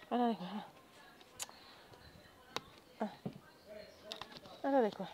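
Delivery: hiccuping between words; talking through hiccups. Real, not acted. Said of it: "sono singhiozzo, chiedo scusa".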